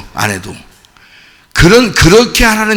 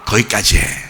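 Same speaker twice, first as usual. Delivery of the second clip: much lower and softer; quiet firm dismissal; 12 semitones lower, 8 dB quieter than usual. Shown as a demonstration. Preacher repeating the rebuke to the congregation, hushed. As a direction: say it under your breath, firmly. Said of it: "그런 겁니다".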